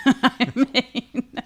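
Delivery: real laugh breaking through; laughing at the story. Real, not acted. Reacting to a child's letter, laughing.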